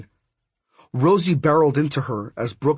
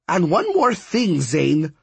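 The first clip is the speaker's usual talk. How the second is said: high character voice, dropping on the name; demanding older woman figure; 4 semitones higher, slower than usual. Acted. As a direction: call out one last demand. Miss Cab's parting demand, voiced.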